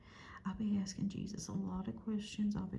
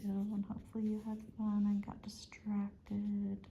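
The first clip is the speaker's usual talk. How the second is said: usual speaking voice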